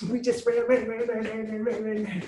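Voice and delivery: high rushing repeated ran; breathless excitement. Retold or imitated. Two kids racing to the house, giddy chant. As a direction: chant it like kids sprinting home.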